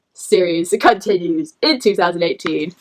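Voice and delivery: doom-laden announcer reading the release date; deep announcer. Pretend. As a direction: intone it like a movie trailer. Mock ominous announcer voice.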